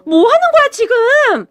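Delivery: high-pitched, indignant yell; outraged yell. A narrator's reading. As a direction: jump up and cry out in protest. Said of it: "이라며 소리를 질렀어요".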